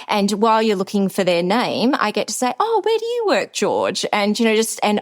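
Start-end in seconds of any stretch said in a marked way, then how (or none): 2.6-4.1 friendly networking chirp; chirpy small talk. Retold or imitated. Asking a delegate where he works, chirpy and high. Chatting while handing out badges.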